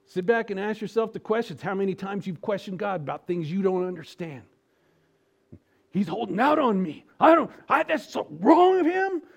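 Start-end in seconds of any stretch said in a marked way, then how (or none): 5.9-9.2 high, loud mocking complaint; hammed-up grumble at God; mock whine. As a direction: whine like a spoiled child.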